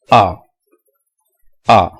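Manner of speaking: A as a lone open "ah"; read without spelling. Shown as a demonstration. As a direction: say A as "ah", each one set apart. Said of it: "say it without spelling".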